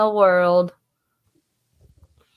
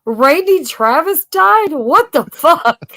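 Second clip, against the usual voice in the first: mock shock; 6 semitones higher, slower than usual.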